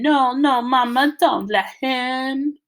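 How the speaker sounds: high, loud mumbled wail; panicked mumbling. A narrator's reading. Byron to Mama while banging the car, panicked mumbling. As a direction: mumble a plea through stuck lips, slowing. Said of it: "and mumbling".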